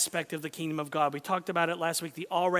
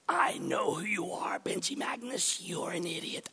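demon voice; 5 dB quieter than usual. Retold or imitated. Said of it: "a demon-possessed man".

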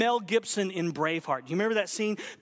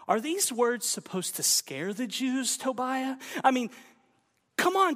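usual speaking voice

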